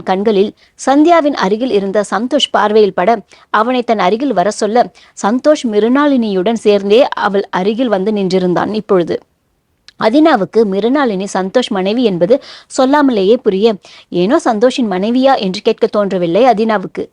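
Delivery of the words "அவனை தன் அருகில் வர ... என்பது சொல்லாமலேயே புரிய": plain reading voice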